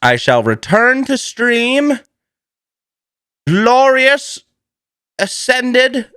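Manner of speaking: high, slow, hammed-up proclamation; mock-grand proclamation. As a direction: announce your return like a triumphant hero. Mock-grand announcement of return.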